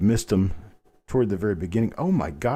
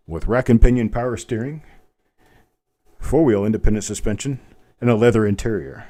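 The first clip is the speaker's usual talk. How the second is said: louder mock car ad read; car commercial; 2 semitones higher, 5 dB louder than usual. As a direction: sell the features like a TV car spot. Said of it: "I'm talking like it's a car".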